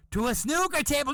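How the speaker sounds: high punched punchline; scandalised reveal. Retold or imitated. Uncle tells the twenty women, high scandalised reveal. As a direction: announce it as the ghastliest detail of all.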